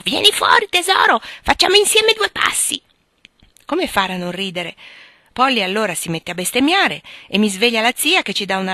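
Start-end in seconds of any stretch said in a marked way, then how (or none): 0.0-2.8 high, loud parrot screech; funny parrot voice. Acted. Parrot calling the spider out from under the bookcase, high squawk. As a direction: coax like a cheeky parrot. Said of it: "con quel suo linguaggio buffo".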